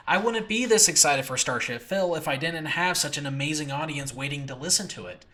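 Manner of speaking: ordinary delivery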